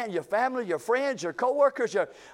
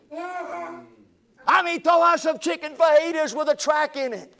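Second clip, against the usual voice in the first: raised, louder preaching drive; preaching at full force; 5 semitones higher, 6 dB louder than usual. Acted. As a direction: lift it and preach it out to the congregation.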